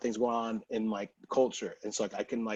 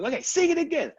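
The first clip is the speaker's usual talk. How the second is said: high, louder excited ask; eager request; 5 dB louder than usual. Retold or imitated. Producer asks him to sing again, excited. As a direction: imitate the producer, much higher. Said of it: "he goes".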